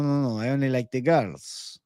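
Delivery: usual speaking voice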